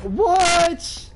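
sharp high disbelieving yelp; shocked. Real, not acted. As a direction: caught totally off guard. Sudden downed in game.

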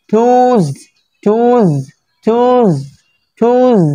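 টুজ with a long "uu" and buzzing z; voiced z ending. Shown as a demonstration. Teacher drilling the z sound, voiced z ending. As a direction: draw out টুজ and finish on z. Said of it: "এটা জেডের উচ্চারণ".

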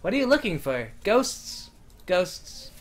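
ordinary talk

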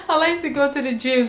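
fighting back giggles while repeating yourself; laughing. Real, not acted. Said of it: "Sorry, I'm laughing because".

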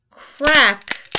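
a loud cracking bed. A narrator's reading. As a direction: snap it out as the bed breaks. Bed breaks.

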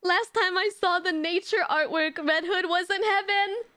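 high, wailing "no" into anxious whine; high-pitched fretting; distressed protest. Real, not acted. Upset on seeing the nature artwork.

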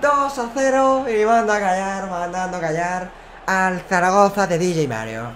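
high, loud, slow victory cry; triumphant hype yell; excited shout. Real, not acted. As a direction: crow about the win to the fans. Celebrating a win to viewers.

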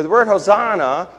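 ordinary delivery

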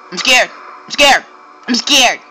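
high, loud pretend fright; exaggerated little yelps of fear; mock scared. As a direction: squeak it repeatedly in fake fear. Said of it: "No, I'm not really".

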